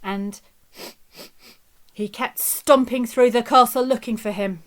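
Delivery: plain reading voice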